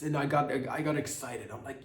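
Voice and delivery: ordinary talk, nothing marked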